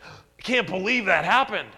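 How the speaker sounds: playful jab at shallow amazement; surprised. Retold or imitated. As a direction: gasp out your disbelief.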